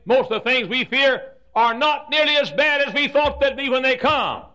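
high, loud preaching voice. Acted. Preacher laying out points to the congregation, loud. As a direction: build it up for the congregation.

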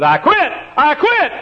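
high, loud cry of giving up; shouted quitting. Retold or imitated. Preacher voicing John Mark, shouting that he quits. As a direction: yell it like a scared boy throwing in the towel.

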